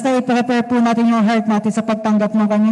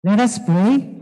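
ordinary talk

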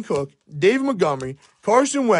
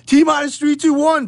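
excited; 10 semitones higher, 4 dB louder than usual. Real, not acted. Countdown before a reveal.

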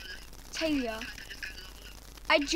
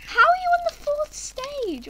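shocked disbelief; 11 semitones higher than usual. Real, not acted. Repeats shocked question.

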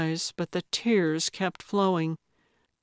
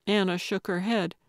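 plain reading voice

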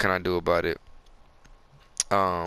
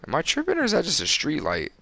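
high-pitched doubting question; puzzled disbelief; 7 semitones higher, faster than usual. Real, not acted. Gamer spotting an odd light, high puzzled question. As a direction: pitch it up, unsure of your own eyes.

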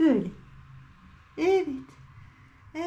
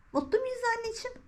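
soft coo; 8 semitones higher, 7 dB quieter, faster than usual. Real, not acted. High soft question to cat.

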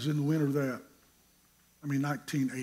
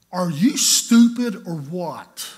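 much louder, slow, scornful jab; mock insult; 4 semitones higher, 11 dB louder, slower than usual. Roommate calling Charles stupid, loud and slow. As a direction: throw it at him with contempt.

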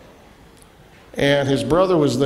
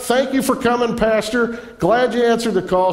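mock gracious thanks; 8 semitones higher than usual.

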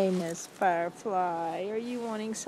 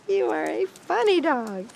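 high, bright sing-song pet voice; 7 semitones higher, 7 dB louder than usual. Real, not acted. Cooing to her dog.

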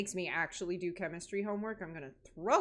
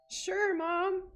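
high, slow, mocking echo; sarcastic mock; 11 semitones higher, 3 dB louder, much slower than usual. Real, not acted. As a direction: throw the words back at mom. Mocking the mother's reassurance.

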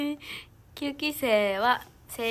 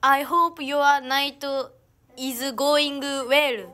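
1 careful slow English; much slower than usual. Real, not acted. Reading English comment aloud.

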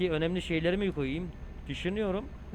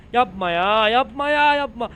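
loud, high panicked cry of "yapma ya"; panicked yelling; 7 semitones higher, 10 dB louder than usual. Real, not acted. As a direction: yell it as the truck nearly goes over. Almost crashes the truck. (panik içinde bağırarak)

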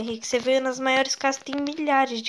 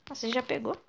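usual speaking voice

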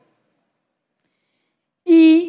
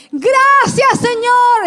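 shouted praise, high and loud; grito de gratitud; 6 semitones higher, 3 dB louder, much slower than usual. Retold or imitated. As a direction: cry thanks up to God before everyone. Testimony, praises God aloud. (gritando gracias a Dios, con voz aguda)

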